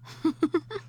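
pleased giggle. Acted. Wife giggling after kissing her husband. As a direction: giggle with delight.

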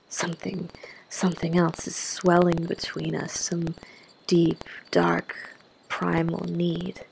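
ordinary delivery

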